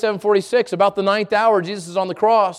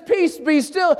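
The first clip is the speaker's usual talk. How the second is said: commanding shout; 6 semitones higher, slower than usual. Retold or imitated. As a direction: call out Peace, be still over the storm. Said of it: "whenever he says".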